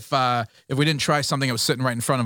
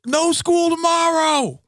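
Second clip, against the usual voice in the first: principal on the mic; 12 semitones higher, 3 dB louder, slower than usual. Retold or imitated. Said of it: "principal's like".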